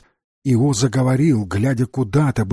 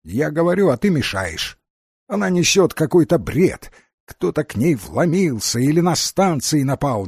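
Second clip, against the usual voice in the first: impatient Fairy explaining herself, higher voice; Фея; 4 semitones higher than usual. Acted. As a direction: argue it away in a higher character voice. (Фея оправдывается, голос повыше)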